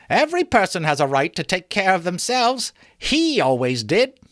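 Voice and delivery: lightly voiced defiant woman; bold woman. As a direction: justify yourself boldly. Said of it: "said the woman".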